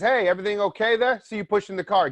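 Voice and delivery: a helpful stranger offering to push; friendly passerby. Retold or imitated. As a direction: call out kindly from the car.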